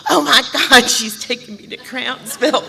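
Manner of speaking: high, loud oh my God, laughing; hammed-up fear, laughing; mock panic. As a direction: pretend alarm at the hospital road. Retold panic at hospital route.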